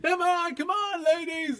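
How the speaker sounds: high-pitched mock pep talk; spin class instructor. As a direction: hype up the class, hammed-up. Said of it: "Amelia spin class".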